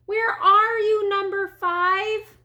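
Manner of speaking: high, slow, drawn-out call. A narrator's reading. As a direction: call from the doorstep for a lost child. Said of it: "stood on her doorstep calling".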